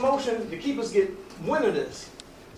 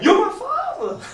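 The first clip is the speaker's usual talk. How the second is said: high, excited answer; excited reply; 13 semitones higher, 5 dB louder than usual. Acted. The son answering King, high and excited. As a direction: lift the voice high with disbelief and joy. Son recognizes his father.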